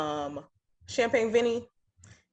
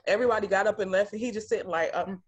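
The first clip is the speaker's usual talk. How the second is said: usual speaking voice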